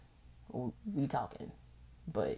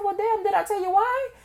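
acted surprise; 9 dB louder than usual. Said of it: "I said".

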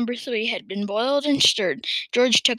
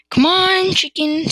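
high, loud coaxing call; calling an animal; 7 semitones higher, 7 dB louder, slower than usual. A narrator's reading. George holding out medicine to a chicken, calling it. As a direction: summon the bird brightly.

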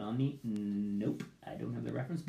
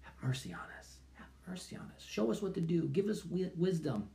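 prayer; 2 semitones higher than usual. Acted. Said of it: "cry out all the more".

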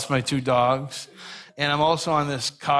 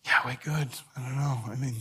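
mildly sheepish, trailing quieter; embarrassed; 2 semitones lower, 6 dB quieter than usual. Retold or imitated. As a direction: answer softly, reluctant to boast. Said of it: "embarrassed to answer".

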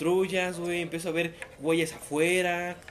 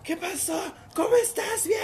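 a hint of an anxious mother, much higher; rapid worried checking, pitched up; worried mom; 6 dB louder, slower than usual. Mom on the phone checking on son, anxious high. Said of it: "Y mamá".

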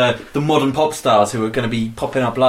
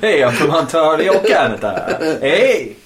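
louder, laughing mock Canadian; mock Canadian accent; 3 semitones higher, 4 dB louder, slower than usual. Friend copying the Canadian joke, laughing. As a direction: greet them like a jokey Canadian. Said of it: "you don't know the accent".

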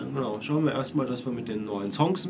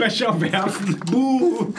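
mock boo; 7 semitones higher, much slower than usual. Mock booing at dance show.

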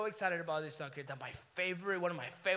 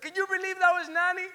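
higher, louder thrilled question; excited disbelief; 11 semitones higher, 8 dB louder than usual. Retold or imitated. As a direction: ask it bursting with excitement. Retelling his excited question to Mercy.

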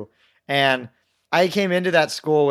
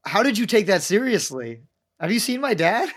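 incredulous; 6 semitones higher than usual. Retold or imitated. Retells his disbelief at gullible friends.

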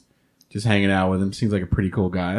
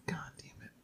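whispered curse; 25 dB quieter than usual. Retold or imitated. His muttered reaction to mom.

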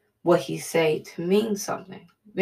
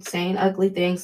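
ordinary talk

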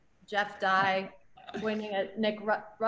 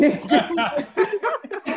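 laughing; 8 semitones higher, 7 dB louder than usual. Real, not acted. Cracking up at garbled accent sentence.

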